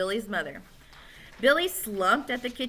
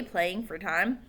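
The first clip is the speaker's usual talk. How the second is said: ordinary delivery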